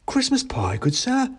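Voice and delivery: high squeaky hawker voice; mouse seller. Acted. A mouse offers the narrator a pie, high and eager. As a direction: pitch it up like a cheery mouse. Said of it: "said the mouse".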